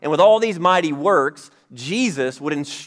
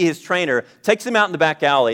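ordinary talk

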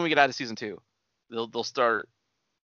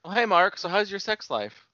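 mock Room quote; 7 semitones higher than usual. Quoting The Room movie line.

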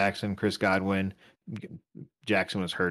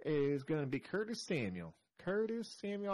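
higher, quieter Southern accent slipping in; 7 semitones higher, 10 dB quieter than usual. Real, not acted. Podcast host naming a player, Southern accent. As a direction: drift into a soft Southern accent on the name. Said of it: "I don't know why I'm doing Southern accent".

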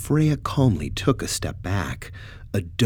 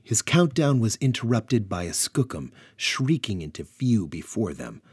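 plain reading voice

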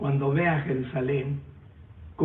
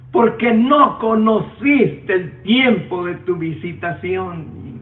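crying out in lament; 4 semitones higher, 6 dB louder, slower than usual. Acted. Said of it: "lloraba a gritos y dice".

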